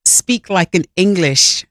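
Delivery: put-on slow, tentative try at an English accent; a hesitant first go at sounding English; attempted English accent. [name], trying out an English accent for Lori, slow and tentative. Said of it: "try it right now".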